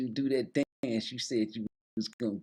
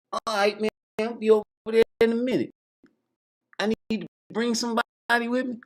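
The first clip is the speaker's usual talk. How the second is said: higher, quick put-on voice; high voiced guest; 11 semitones higher, 5 dB louder, faster than usual. Caller agreeing to come over, in a higher, lightly put-on voice. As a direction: rattle it off in a raised voice. Voicing [name] on phone.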